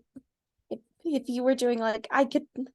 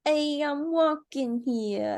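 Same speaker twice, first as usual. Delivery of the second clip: put-on New York accent; 5 semitones higher, much slower than usual. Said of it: "can you do a New York accent?".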